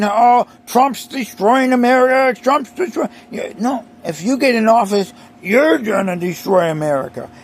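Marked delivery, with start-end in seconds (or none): 0.0-3.1 higher mock whine of the other side; mocking upset protesters. Mocking protesters who shout about Trump, higher mock whine. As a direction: parody their alarm, lightly. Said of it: "they're all upset saying".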